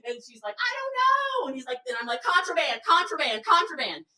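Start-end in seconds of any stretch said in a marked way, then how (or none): 0.6-1.5 very high mock whine; helpless whine. Scolded walker answers the partner, helpless high whine. 2.2-4.1 high repeated accusing call; mock alarm. Organizer denounces the candy bar, high mock alarm.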